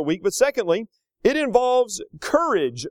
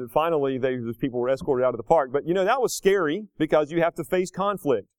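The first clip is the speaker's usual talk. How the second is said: usual speaking voice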